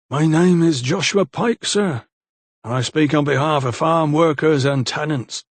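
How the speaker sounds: lightly voiced bold farmhand; lightly voiced insolent young man. A narrator's reading. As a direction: speak up politely but cheekily. Pike introduces himself.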